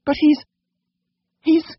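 very high, faltering falsetto; flustered lady. A narrator's reading. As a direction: break off, too embarrassed to finish. Lady too embarrassed to say.

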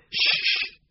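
sharp hushing shush; urgent shush. A narrator's reading. Vet silencing the old man, urgent shush. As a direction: cut him off with a hiss.

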